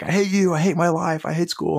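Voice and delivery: mock child's angry outburst from the back seat; child tantrum. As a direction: imitate a furious kindergartner screaming at dad. Kid kicking seat, yelling at dad.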